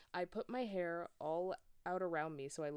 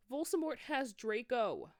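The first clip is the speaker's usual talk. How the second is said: higher, louder urgent shout; 6 semitones higher, 6 dB louder than usual. Acted. Students telling Dumbledore the news, higher urgent shout. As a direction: blurt it out in alarm. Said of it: "we shouted at the same time".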